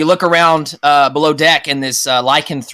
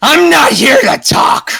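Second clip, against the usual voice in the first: slow, high roaring threat; lycanthrope's angry shout; 12 semitones higher, 6 dB louder, slower than usual. Acted. Lycanthrope lurching at her shocked prey, slow furious shout. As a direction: roar it out like an attacking werewolf. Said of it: "she says".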